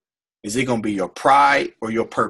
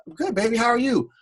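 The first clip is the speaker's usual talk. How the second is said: higher, sweet motherly voice; his mom answers her son, higher and sweet; voicing his mom; 10 semitones higher than usual.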